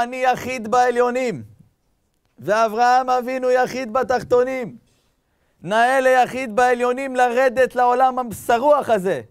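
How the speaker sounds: loud, high, slow declaration; booming proclamation. Retold or imitated. God answering the angel Gabriel, booming proclamation. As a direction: proclaim it at full voice, drawn out.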